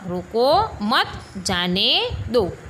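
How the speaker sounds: slow "रोको", held before "मत"; measured, phrase-by-phrase "रोको मत, जाने दो"; comma pause demo. Shown as a demonstration. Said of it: "दिखाई दे रहा है ना अल्प विराम".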